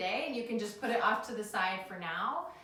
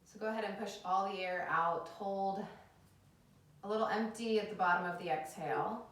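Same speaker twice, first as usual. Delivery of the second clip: calm them down into the breath; soothing yoga teacher; 3 dB quieter than usual. Acted.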